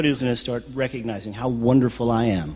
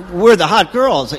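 excited; 5 semitones higher, 9 dB louder, slower than usual. Retold or imitated. Excited thought about girls arriving.

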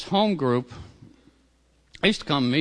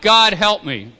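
retold yell; 7 semitones higher, 8 dB louder, slower than usual. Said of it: "and I just yelled out".

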